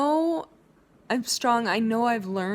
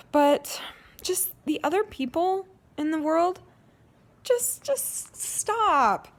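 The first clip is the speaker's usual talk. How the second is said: upset pleading; 8 semitones higher than usual. Real, not acted. Vlogger telling strangers to stop mocking, upset and high.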